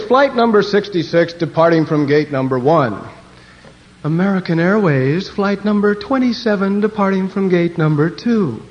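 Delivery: mock airline PA voice; airport announcer. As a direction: announce flights over a terminal PA. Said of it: "listening to them announce the planes".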